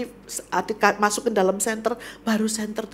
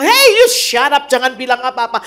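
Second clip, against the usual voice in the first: shouted hushing; 4 semitones higher, 14 dB louder than usual. Acted. Bystanders silencing the blind beggar, shouting. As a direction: shut him up from the crowd.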